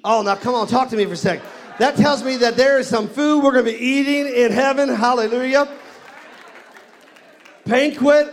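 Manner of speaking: loud, high-pitched, exuberant preacher; full-force preaching. Real, not acted. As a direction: fire up the congregation at full volume.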